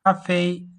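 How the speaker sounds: "cafe" high and loud, both syllables level; high-pitched tones. Shown as a demonstration. Teacher modelling a two-syllable word, "cafe" high and level. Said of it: "they are all high-pitched tones".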